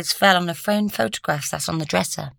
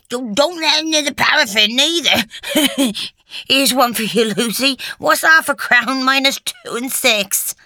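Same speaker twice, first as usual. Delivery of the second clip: high, loud teasing character voice; heckling resident; 7 semitones higher, 5 dB louder than usual. A narrator's reading. Residents poke fun at Lucy by the fire, high heckling. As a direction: heckle Lucy in a raised, put-on voice.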